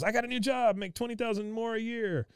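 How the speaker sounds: high eager boast; mock boastful. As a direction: brag up high about the new job. [name] boasts to Min.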